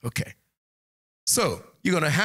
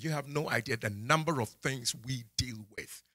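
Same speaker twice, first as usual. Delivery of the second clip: ordinary talk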